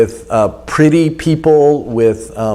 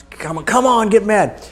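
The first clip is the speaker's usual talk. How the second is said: urging on; 6 semitones higher than usual. Retold or imitated. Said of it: "when I see somebody and I'm".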